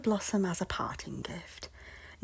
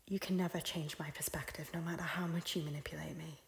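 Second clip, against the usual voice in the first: quiet defiance; 2 semitones lower, 8 dB quieter, faster than usual. Acted. Guarded defiance of her lord.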